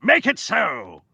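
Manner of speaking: slow, high theatrical order; mock commander. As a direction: give a drawn-out grand command. Jokey commanding put-on voice.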